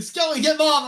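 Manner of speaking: loud, high-pitched holler; imitated brother's frantic shout; brother yelling. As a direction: belt it out as an urgent call. Brother calling out in story.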